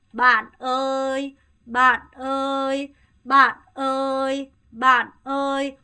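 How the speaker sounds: 만어이 stretched slowly, level and loud; slow level call. Shown as a demonstration. Teacher drills calling a friend, slow level call. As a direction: call 만어이 long and flat. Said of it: "친구야 하면 어떻게".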